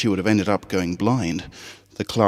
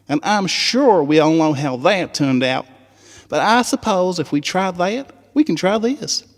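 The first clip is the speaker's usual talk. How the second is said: much higher, Southern twang, eager; high country filly voice; 10 semitones higher than usual. Acted. Apple Bloom rallying the Crusaders on the school trip, high Southern twang.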